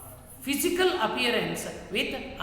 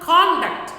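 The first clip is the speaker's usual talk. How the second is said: CONduct, first syllable high, loud and drawn out; stress on first syllable; 6 semitones higher, 10 dB louder than usual. Shown as a demonstration. Teacher explaining noun stress, CONduct hit hard up front. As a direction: punch the CON in conduct, slowly. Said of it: "first letter".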